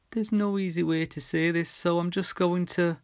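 bracing herself to say it; breaking bad news. A narrator's reading. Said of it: "taking another two huge gulps of air".

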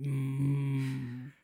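ordinary talk

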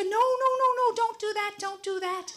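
high, loud panicked pleading; frantic no. Retold or imitated. Wife leaping from bed to stop her husband, high panicked shouting. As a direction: stop him before it's too late.

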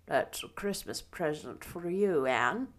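low, soft, bashful voice; shy older man. A narrator's reading. Matthew gives Anne her Christmas present, shyly. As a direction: offer it softly and sheepishly. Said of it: "said Matthew shyly".

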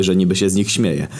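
ordinary delivery, nothing marked

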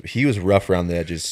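usual speaking voice

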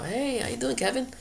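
high, cheery hello; cheery greeting. Retold or imitated. Greeting Kevin on the street, a high cheery hello. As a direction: sing out a friendly hello across the street. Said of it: "They go".

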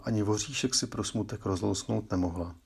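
ordinary delivery, nothing marked

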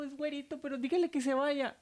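high-pitched plea; imitated sister pleading. Sister asking the nurse to send the boy away, pleading. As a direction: imitate a distressed patient in a high voice.

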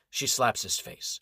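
plain reading voice

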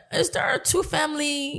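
high, soft imitated Caribbean accent; bad Caribbean accent. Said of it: "my bad Caribbean accent".

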